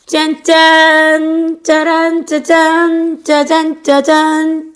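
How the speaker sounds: high, loud ta-da; ta-da fanfare. Acted. Teacher showing kids the finished fold, loud high ta-da. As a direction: present it with a flourish.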